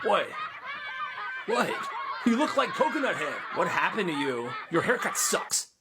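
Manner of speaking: cackling schoolkid taunt; laughing mockery. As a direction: ridicule the haircut. Classmates mock his haircut.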